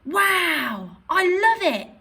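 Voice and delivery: high, loud, thrilled; excited. Shown as a demonstration. Teacher demonstrating excitement for an exclamation mark. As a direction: gush about the summer holidays. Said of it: "You might use it for surprise or excitement".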